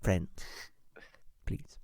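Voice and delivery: breathy pleading whisper. Acted. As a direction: hiss it under your breath. Begging whisper to friends.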